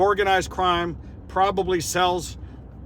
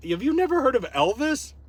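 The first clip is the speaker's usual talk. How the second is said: high-pitched, disbelieving question; retold incredulity; 9 semitones higher than usual. Vlogger explains the lightning bolt to his accuser, incredulous. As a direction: act astonished at his ignorance.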